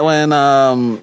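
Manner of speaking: ordinary talk, nothing marked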